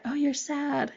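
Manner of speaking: lightly voiced nagging little voice; mild taunting put-on voice; mock inner critic. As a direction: pretend to be a needling dark thought, lightly. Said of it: "the little bastards whispering to us".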